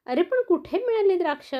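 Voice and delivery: eager question. Acted. Tortoise asks eagerly.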